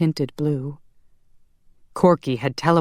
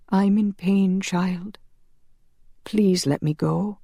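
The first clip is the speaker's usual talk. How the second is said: higher, pained, frail plea; dying priest; 5 semitones higher than usual. A narrator's reading. Priest begs Marielle to let him go, pained. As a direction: beg for release with failing strength.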